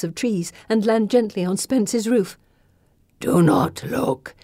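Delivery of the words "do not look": very slow gravelly gargoyle voice; gargoyle's advice. A narrator's reading. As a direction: advise slowly, as a creature of stone. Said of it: "he advises".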